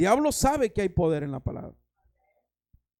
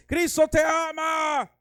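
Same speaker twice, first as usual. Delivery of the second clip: higher, slow shouted "Cristo te ama"; 6 semitones higher, slower than usual. Retold or imitated. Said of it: "más fuerte". (gritando "Cristo te ama" lento y más agudo)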